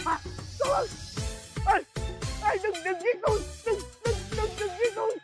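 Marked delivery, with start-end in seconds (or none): 1.7-5.2 terrified pleading. Acted. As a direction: plead in terror not to be killed. Begging a ghost not to kill.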